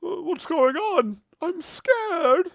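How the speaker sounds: high scared merchant voice. Acted. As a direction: squeak it out like a terrified merchant. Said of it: "looks at you and goes".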